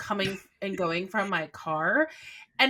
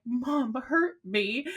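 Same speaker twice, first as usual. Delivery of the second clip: put-on slow, whiny little-kid voice, pitch up; imitating hurt toddler; 5 semitones higher, much slower than usual. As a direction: repeat the toddler's sulky complaint, lips trembling. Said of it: "that bottom lip just kept quivering".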